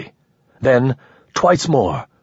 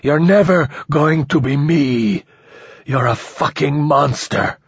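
gasping anger; 5 semitones higher than usual. A narrator's reading. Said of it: "the man gasped".

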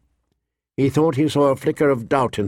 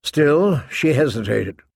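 ordinary delivery, nothing marked

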